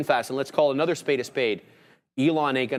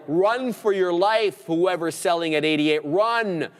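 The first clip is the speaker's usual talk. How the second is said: excited auctioneer calling prices, pitched up; 6 semitones higher than usual. Real, not acted. Trader urging short sellers to flee, auctioneer voice. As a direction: call it out like a rising auction bid. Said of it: "I got my auctioneer voice on".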